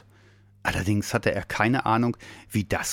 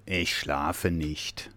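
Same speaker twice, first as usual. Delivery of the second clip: low, very slow bear grumble; grumbling bear; 5 semitones lower, 5 dB quieter, much slower than usual. A narrator's reading. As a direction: grumble sleepily, low and drawn out. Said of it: "öffnete ein Auge und brummte". (tief und langsam gebrummt)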